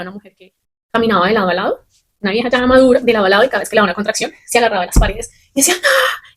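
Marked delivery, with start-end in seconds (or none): 5.8-6.3 short high-pitched "¡Ah!" cry, mimicking the woman; imitated labor scream. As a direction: echo her sudden pain scream, pitched up high. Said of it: "y gritaba". (grito de dolor imitado, agudo)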